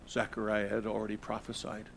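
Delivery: ordinary talk, nothing marked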